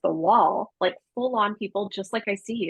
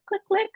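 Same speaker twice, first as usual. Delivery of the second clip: imitated remote chirp. Imitating car remote starter chirp.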